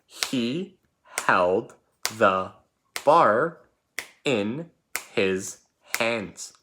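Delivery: a teacher repeating the sentence word by word so the class can write it; word-by-word dictation. Shown as a demonstration. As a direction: break it word by word and punch bar. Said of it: "Once again, sentence number two is".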